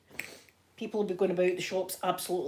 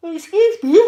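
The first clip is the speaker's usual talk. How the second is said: said through a big yawn; 9 semitones higher, 15 dB louder than usual. Real, not acted. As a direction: yawn the words out. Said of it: "That yawn came from absolute".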